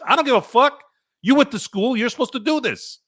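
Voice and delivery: high-pitched, indignant outburst; angry rant. Real, not acted. Ranting at a doctor who screwed up, angry. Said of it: "now you know where the anger comes from".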